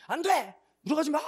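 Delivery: high, loud guard's yell; acted high-pitched shout; shouted refusal. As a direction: imitate a servant frantically forbidding entry. Servants refusing entry, acted.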